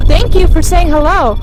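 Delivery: startled and thrilled; gasping surprise. Real, not acted. REM pod lights up, she gasps.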